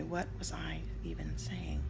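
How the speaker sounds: whisper. Real, not acted. Vlogger losing her thread after squirrel, whispered aside.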